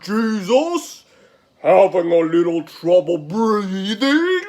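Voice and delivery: loud, high taunt with a laugh; shouted mockery. Crowd member mocking Jesus and calling to Romans, loud jeer. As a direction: heckle loudly, swooping high, laughing partway through. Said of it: "Another one shouts".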